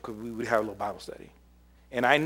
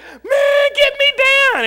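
much higher, loud scared yell; panicked yell; 14 dB louder, much slower than usual. Retold or imitated. Scared kid on high ropes.

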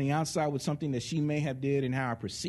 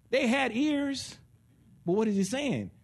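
usual speaking voice

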